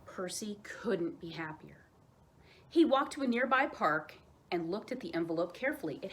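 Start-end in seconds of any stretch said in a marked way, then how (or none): 0.0-1.9 slow soft whisper; hushed delight. A narrator's reading. Narrator telling kids of Percy's joy, slow whisper.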